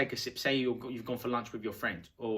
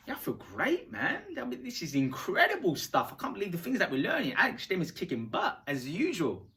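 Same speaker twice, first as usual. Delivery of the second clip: gushing enthusiasm; 6 semitones higher than usual. Shown as a demonstration. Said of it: "and go".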